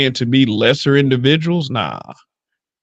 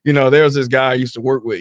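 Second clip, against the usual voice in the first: ordinary delivery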